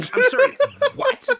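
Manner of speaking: laughing, loud and high; laughing disbelief. Real, not acted. Listener laughing in disbelief at seamen. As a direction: burst out laughing mid-question.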